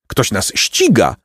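ordinary delivery, nothing marked

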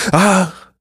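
high exclamation of wonder; exclaiming. A narrator's reading. Golden lord to bread eaters, exclaiming. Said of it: "wykrzyknął złoty pan".

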